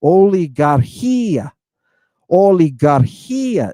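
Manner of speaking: Oligarchia slow, each syllable clear; slow model word for students to repeat; Greek pronunciation. Shown as a demonstration. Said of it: "here's a nice word you can practice".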